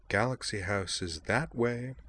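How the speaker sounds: soft, menacing character voice; lightly voiced quiet threat; silky menace. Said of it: "in a soft, menacing voice".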